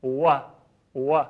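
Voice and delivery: «و» with lips gathered round; Arabic w. Shown as a demonstration. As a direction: shape a round w for «و».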